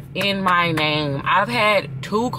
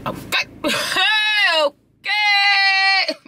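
laughing squeal; much slower than usual. Real, not acted. Squealing over getting what she wants.

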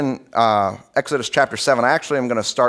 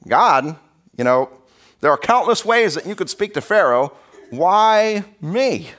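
pleading Moses; 6 semitones higher than usual. Retold or imitated.

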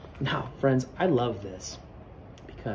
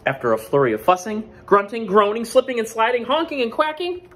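building excitement; 11 semitones higher, 6 dB louder than usual. A narrator's reading. Narrating a scuffle.

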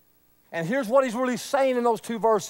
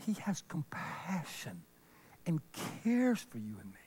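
much softer and lower; almost whisper it gently; tender hush about compassion; 7 semitones lower, 14 dB quieter than usual. Real, not acted. Hushed tender line.

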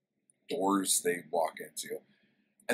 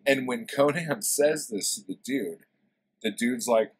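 ordinary delivery

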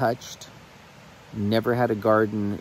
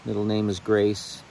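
heartfelt prayer; 2 semitones higher than usual. Real, not acted. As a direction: pray aloud to the Lord from the heart. Sorry, guys, if I get a little bit emotional.

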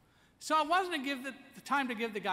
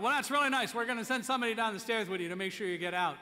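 insistent firefighter; 5 semitones higher, 8 dB louder, faster than usual. Retold or imitated.

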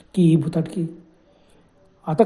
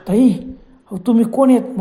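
higher, louder frightened cry; frightened shout; 4 semitones higher, 6 dB louder, slower than usual. Retold or imitated. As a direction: yell out in fear at the stranger. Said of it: "तो ओरडला". (घाबरून मोठ्याने ओरडणे)

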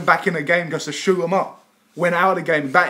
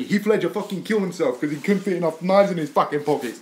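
normal talking voice

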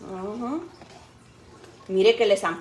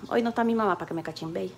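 normal talking voice

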